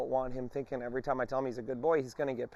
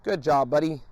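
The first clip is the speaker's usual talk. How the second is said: upbeat praise, louder; 2 semitones higher, 5 dB louder than usual. Shown as a demonstration. Trainer praising the dog for walking right. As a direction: reward him with your voice. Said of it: "I tell him".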